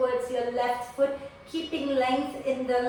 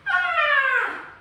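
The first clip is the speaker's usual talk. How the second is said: high open-throated scream, much higher; gentle scream; 4 dB louder than usual. Shown as a demonstration. Prenatal yoga teacher demonstrating a gentle scream. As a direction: let out a demo scream for the class. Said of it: "I want you to scream".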